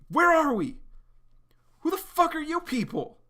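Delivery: high, loud alarmed demand; confused shout. Acted. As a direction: yell at the strangers. John confused and hostile.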